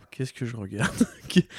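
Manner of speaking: ordinary talk, nothing marked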